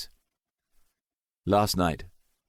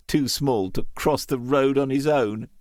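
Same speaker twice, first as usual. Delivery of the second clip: plain reading voice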